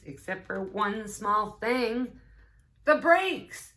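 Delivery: rising to a high, loud cry on the brakes; slow build into a panicked squeal; building alarm. Acted. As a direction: build suspense then yelp the punchline. Punchline about missing brakes.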